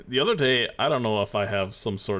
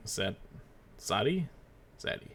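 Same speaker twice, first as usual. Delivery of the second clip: usual speaking voice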